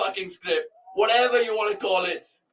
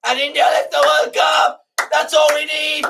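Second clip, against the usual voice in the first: loud, higher victory commentary call; jubilant shouting; 4 semitones higher, 5 dB louder than usual. Real, not acted. Fan calling the last ball to viewers, jubilant shout. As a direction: bellow the win like a commentator.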